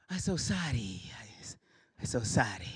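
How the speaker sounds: whispered, meek put-on voice; hushed, overly polite sorry; mock whispered apology. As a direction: apologize timidly under the breath.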